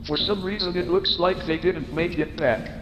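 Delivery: ordinary talk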